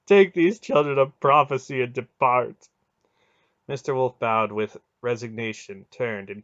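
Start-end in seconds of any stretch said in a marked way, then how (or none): 0.0-2.7 loud, grieving god's lament; anguished groaning. A narrator's reading. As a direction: cry it out in bitter despair. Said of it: "Mara groaned".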